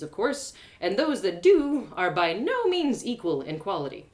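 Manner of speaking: ordinary delivery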